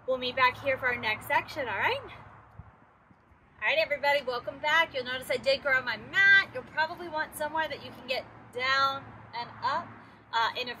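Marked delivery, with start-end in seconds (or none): none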